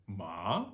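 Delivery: rising tone on ma; rising second tone. Shown as a demonstration. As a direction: lift ma upward like asking a question. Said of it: "Second tone is the Scooby-Doo tone".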